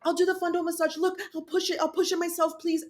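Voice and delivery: high, frantic begging; desperate pleading. Retold or imitated. Patient begging the surgical team, high and desperate. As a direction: beg them to let you do it. Pleading through pain in surgery.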